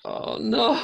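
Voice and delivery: high-pitched dismayed oh no; a sudden yelp of dismay; surprised. Real, not acted. Watching the monk's jump.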